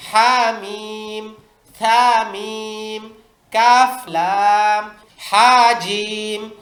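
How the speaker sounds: put-on very slow, drawn-out letter names for children; slow drill reading. Shown as a demonstration. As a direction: spell each pair out slowly for the class to copy. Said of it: "উস্তাদ এভাবে বাচ্চাদের practice করাই দিবেন". (ধীরে টেনে টেনে হরফ পড়া)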